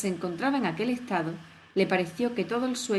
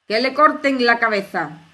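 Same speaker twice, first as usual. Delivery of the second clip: grita mucho más fuerte, con toda la fuerza; chillido a pleno pulmón; 3 semitones higher, 11 dB louder than usual. Acted. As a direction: ordena la ejecución a gritos. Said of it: "chilló la reina con toda la fuerza de sus pulmones". (la reina chilla la sentencia contra Alicia, a pleno pulmón)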